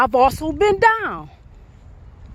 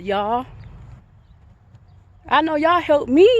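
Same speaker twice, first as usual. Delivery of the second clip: ordinary delivery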